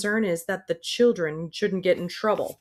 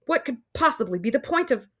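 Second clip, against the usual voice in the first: panting, breath running out; 7 semitones higher than usual. Acted. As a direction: gasp it out, breathless. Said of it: "That's all the breath I have left".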